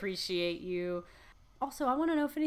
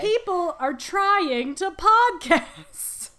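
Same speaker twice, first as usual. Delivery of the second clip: loud, slow, higher mock exasperation; exasperated outburst; 8 semitones higher, 9 dB louder, much slower than usual. Fed-up host complaining about barking dogs, loud and slow. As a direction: drag it out in exasperation.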